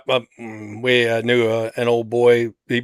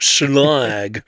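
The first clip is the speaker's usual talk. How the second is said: mock British accent on one word. Shown as a demonstration. Correcting a co-host on a robot's name, mock British accent. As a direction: put on a British accent, drawn out. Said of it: "say it with a British accent".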